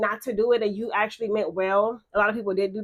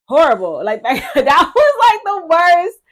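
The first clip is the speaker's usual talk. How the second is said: loud outburst; 6 semitones higher, 9 dB louder than usual. Real, not acted. Venting about a horrible period.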